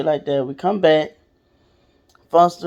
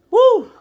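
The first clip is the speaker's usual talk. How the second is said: shaky emotional exhale; 5 dB louder than usual. Real, not acted. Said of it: "it's hard to talk about".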